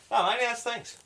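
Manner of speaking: high soft mumble, barely heard; mumbling. Retold or imitated. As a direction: repeat it in a mumble. Dad repeats, still mumbling.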